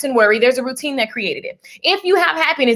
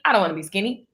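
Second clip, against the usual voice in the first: dropped lower, dismissive about-face; mock refusal; 5 semitones lower, 3 dB quieter, much faster than usual. Acting out flip-flop energy, mock low refusal. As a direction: drop down for the contrary side.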